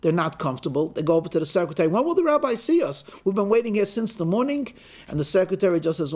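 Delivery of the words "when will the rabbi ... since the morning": high, quick, impatient demand; acted exasperation, pitched up and hurried; impatient complaint. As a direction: pester someone after a long wait. Two tired men pester the secretary.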